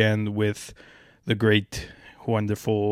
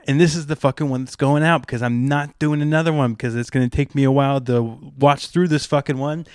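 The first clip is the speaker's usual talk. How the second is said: ordinary talk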